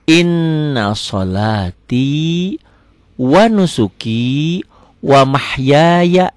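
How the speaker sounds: slow recitation, long n in "Inna"; held n. Shown as a demonstration. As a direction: hold "Inna" on the n, release the a. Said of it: "N-nya ditahan".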